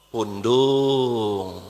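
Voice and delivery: low, drawn-out sulky word; sulking voice, mocking the touchy. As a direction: drop into a pouting sulk. Mocking sulky word after shout.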